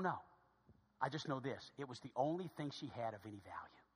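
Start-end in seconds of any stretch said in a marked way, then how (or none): 1.8-3.8 low, very soft statement; hushed. Real, not acted. Preacher telling church about the widow's only possession, hushed. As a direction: drop it low, almost under the breath. Quiet first take, then repeated emphatically.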